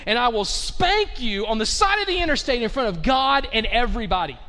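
loud, emphatic threat from the driver's seat; fed-up parent. Acted. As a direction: warn the kids, at the end of your rope. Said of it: "he turns around and says".

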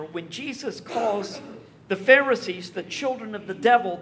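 ordinary delivery

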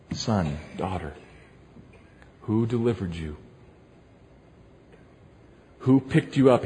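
wooing voice. Shown as a demonstration. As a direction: draw them in with soft, low tenderness. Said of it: "And in his best wooing voice".

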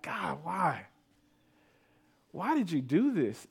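re-live a wounded, pleading question; hurt, angry plea. Retold or imitated. Grieving father questioning God in retelling, hurt and pleading. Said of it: "I was hurt. I had prayed. I was mad. I was angry".

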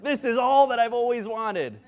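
hammed-up Christmas-morning rapture; ecstatic kid. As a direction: wail with joy like a child amid wrapping paper. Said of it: "and just like".

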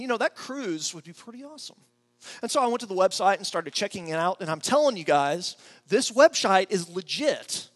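usual speaking voice

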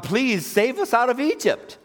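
high, drawn-out plea; hammed-up desperate begging. Israelites praying to God for rescue, high slow begging. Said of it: "begged God".

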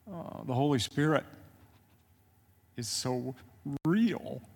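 hushed, halting, moved by awe; real choked-up speech with long pauses; overcome, can hardly talk. Real, not acted. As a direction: struggle to get the words out. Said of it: "'cause I can't hardly talk".

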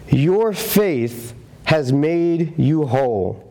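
"Your faith has made you whole" spoken very slowly; slow, gentle blessing; slow, tender pronouncement. Shown as a demonstration. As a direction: linger over every word, gently. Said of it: "He said".

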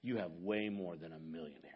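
measured low preacher voice with weighty pauses; Doctor Rogers. Retold or imitated. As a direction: drop way down into a grave, fatherly baritone. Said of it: "in his deep baritone voice".

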